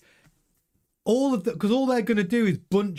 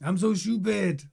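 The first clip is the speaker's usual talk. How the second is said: put-on Leclerc's French accent; 3 semitones higher than usual. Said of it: "That's my French accent".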